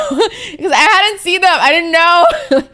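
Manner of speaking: high, loud words broken up by laughter; laughing. Real, not acted. Streamer laughing to chat about surprise applicants, laughing through words.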